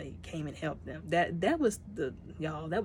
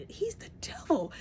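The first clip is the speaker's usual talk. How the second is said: high, slow squeal of disgust; the teller calling the villain evil, slow and high; indignant outcry; 12 semitones higher than usual. Retold or imitated. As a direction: drag it out, appalled. Teller's outraged reaction.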